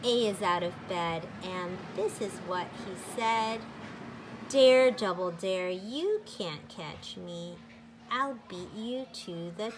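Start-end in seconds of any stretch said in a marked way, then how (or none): none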